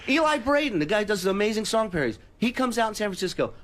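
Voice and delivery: ordinary delivery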